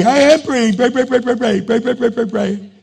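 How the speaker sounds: high, loud rapid repeated pray; frantic praying. Retold or imitated. Pastor insisting to God he is praying, frantic and loud. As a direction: rattle off the prays in a frenzy.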